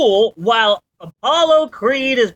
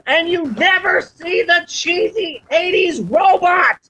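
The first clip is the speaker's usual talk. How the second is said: high, indignant outburst; outraged exclamation; 10 semitones higher than usual. Real, not acted. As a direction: exclaim it in disbelief. Ranting about cut robot scene.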